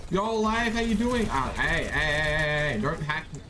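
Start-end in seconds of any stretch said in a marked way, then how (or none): 0.1-1.3 high playful greeting. Pretend.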